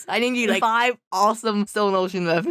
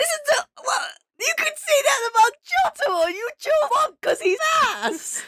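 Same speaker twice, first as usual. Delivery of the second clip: outraged. Real, not acted. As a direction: shout it in disbelief. Outraged objection in argument.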